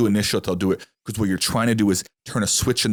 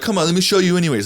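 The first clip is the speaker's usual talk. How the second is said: mock pushy car salesman, higher and louder; hammed-up salesman ignoring what the buyer said; 8 semitones higher, 5 dB louder than usual. A salesman pushing a buyer who said no Fords, mock pushy. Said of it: "I hate when people are like".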